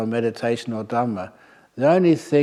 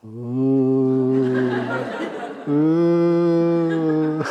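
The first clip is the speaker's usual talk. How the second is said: long, rising mock ghostly "ooh"; pretend ghost wail; 2 semitones higher, 4 dB louder than usual. Monk playing ghost to scare off villagers, hammed-up wail. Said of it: "I thought I should go".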